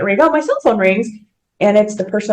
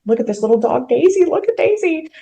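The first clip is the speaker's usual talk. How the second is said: coaxing husband; 6 semitones higher than usual. Retold or imitated. Husband shows wife a puppy he wants, eager coaxing. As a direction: pitch leaping high, imitating an eager husband.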